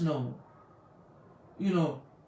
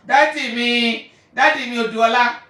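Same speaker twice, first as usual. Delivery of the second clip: loud fond calling; 3 semitones higher, 10 dB louder than usual. Real, not acted. Greeting her father by name, loud and fond. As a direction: call him by name with delight.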